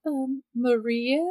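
lightly voiced puzzled stranger; confused intruder. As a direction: draw it out, tentative and puzzled.

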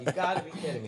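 mock disbelief. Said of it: "That's what she said".

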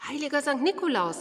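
fast, high breathless exclamation. A narrator's reading. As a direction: gasp it out in excitement. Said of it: "sagte die alte Dame atemlos".

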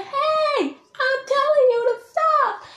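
very high sustained yell; escalated mock yell. Shown as a demonstration. Creator plays one partner ordering the other, high mock yell. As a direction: shout the order at the top of your pitch. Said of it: "It's that kind of yelling".

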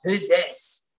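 mildly gruff man on the phone. Retold or imitated. Retelling his answer to her call, mildly gruff. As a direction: lightly imitate a gruff man's curt question. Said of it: "in this really gruff voice".

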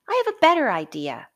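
high, loud, fast bright idea; eager boy. A narrator's reading. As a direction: blurt it out like a boy with a plan. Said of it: "said Jack".

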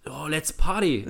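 loud, high, drawn-out cheer; hammed-up party animal; mock party shout. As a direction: holler to a festival crowd. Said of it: "Und dann hat er sich da feiern lassen".

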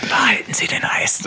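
high, secretive whisper; conspiratorial whisper. Pretend. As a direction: whisper with a wink. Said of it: "Und dann so zwinkern". (verschwörerisch geflüstert)

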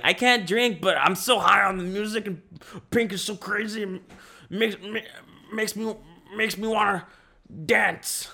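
put-on high, excited teenage gush; giddy teen voice. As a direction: squeal it like a hyped-up teen. Quoting teen self at pool.

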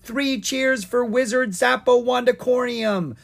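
high, loud rallying cheer; crying out. A narrator's reading. Young dragon hailing the wizard, crying out. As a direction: lead the crowd in three cheers. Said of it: "Owen cried out".

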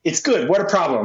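ordinary delivery

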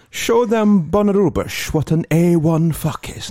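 mock Dutch-accented English, slow and loud; Dutch porn narrator. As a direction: stretch every word like a sleazy Dutch host. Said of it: "a Dutch guy with really bad English".

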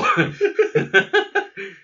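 loud squealing laugh; high-pitched laugh. Real, not acted. Laughing at the quote.

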